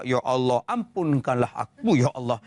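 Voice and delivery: low, drawn plea; demonstrated Malay prayer. Shown as a demonstration. Worshipper wrongly praying in Malay mid-prayer, low imploring voice. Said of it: "Jangan tukar bahasa Melayu pula".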